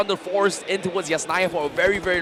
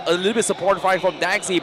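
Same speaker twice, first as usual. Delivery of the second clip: normal talking voice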